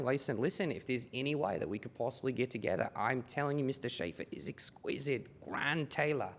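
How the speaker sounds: a salesman's mock Australian accent; fake Australian accent. Shown as a demonstration. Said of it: "approached me with the accent".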